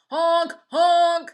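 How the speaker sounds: loud, high honking like car horns; car horn honks. A narrator's reading. Cars honking at the crossing ducks, loud horn honks. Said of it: "went the horns on the speeding cars".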